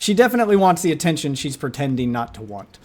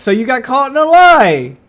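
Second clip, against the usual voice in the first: louder and higher, slow and mocking; gleeful taunting shout; 11 semitones higher, 8 dB louder than usual. Real, not acted. Commentator mocks the girl caught lying by police, loud taunting. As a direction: drag each word out in triumph.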